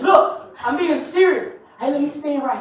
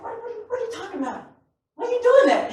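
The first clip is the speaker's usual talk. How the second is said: mock baffled; 6 semitones higher, 3 dB quieter, faster than usual. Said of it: "I was like".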